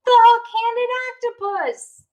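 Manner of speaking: high, loud terrified shout; panicked cry. Acted. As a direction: yell out the monster's name. Kids panic in dark.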